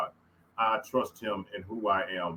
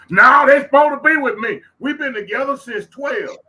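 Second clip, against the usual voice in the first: high, loud mocking whine of someone clinging; hammed-up protest, refusing to let go; high, loud mock plea; mock whiny pleading; 14 semitones higher, 12 dB louder than usual. Mocking clinging to old friends.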